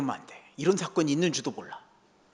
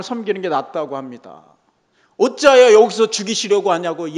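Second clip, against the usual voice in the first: louder, higher pleading complaint to God; Moses complaining; 4 semitones higher, 7 dB louder than usual. Retold or imitated. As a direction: press the grievance hard, slowing on the heavy parts. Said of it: "불평하지 않았을까요?".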